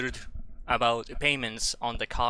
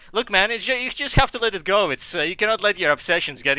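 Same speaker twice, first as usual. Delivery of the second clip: high, louder insistence; pleading; 7 semitones higher, 7 dB louder than usual. Acted. Gamer urging himself to let the whale go, high and loud. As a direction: press the point, half joking.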